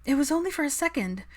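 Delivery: put-on high bright girlish voice; excited friend. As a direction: gush it with delight. Daphne voiced.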